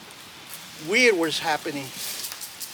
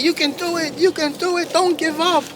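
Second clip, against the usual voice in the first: high, eager cheering from the animals; root for him with a lifted voice; cheering crowd; 8 semitones higher than usual. Acted. Animals cheering grizzly bear on.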